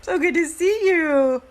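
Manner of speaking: high, loud, gushing hello to a friend; excited greeting. Real, not acted. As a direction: call out her name with delight. Greeting a viewer on live.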